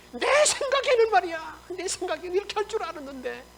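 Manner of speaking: high, indignant grumble, easing in volume; acted indignation. Naaman expected a different healing, acted indignant complaint. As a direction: complain in mock offence. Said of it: "막 화를 내면서".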